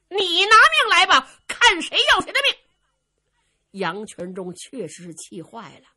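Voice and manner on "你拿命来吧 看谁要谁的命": high, loud, quick mocking challenge; scornful taunt. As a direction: throw his threat back at him. Luo Cheng taunts his challenger.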